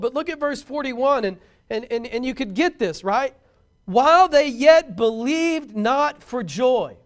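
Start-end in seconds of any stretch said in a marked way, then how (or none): 3.8-7.0 loud, slow, ringing King James reading; booming preacher reading scripture; emphatic scripture reading. Acted. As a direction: proclaim the verse slowly and loudly.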